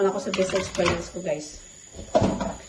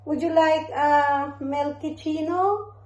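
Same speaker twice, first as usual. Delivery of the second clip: high, slow, sing-song offer; cooing mommy voice; 4 semitones higher, much slower than usual. Real, not acted. Mother offers baby milk.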